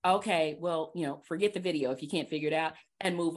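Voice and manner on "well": ordinary talk, nothing marked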